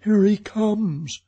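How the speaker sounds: high, loud cry of warning; Christine crying out. A narrator's reading. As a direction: call out in alarm, pitched right up. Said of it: "cried Christine".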